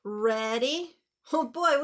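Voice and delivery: ordinary talk, nothing marked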